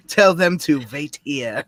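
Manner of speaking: slow mock German accent. Quoting the German in the bar, slow German accent. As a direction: draw it out in a put-on German accent. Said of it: "when the German came into the, into the bar".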